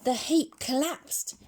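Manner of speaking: ordinary delivery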